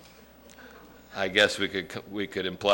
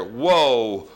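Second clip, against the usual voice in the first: usual speaking voice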